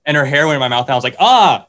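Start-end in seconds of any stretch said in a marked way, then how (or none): 1.2-1.7 short, high, loud "ah"; startled mock yelp. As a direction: re-enact a startled cry. Retold reaction to hair in mouth.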